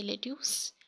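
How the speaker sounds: "लेट्यूस" as le-tyoos; mispronounced "लेट्यूस", ends like use; wrong pronunciation. Shown as a demonstration. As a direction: say "लेट्यूस" with a long yoo ending. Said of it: "Not lettuce".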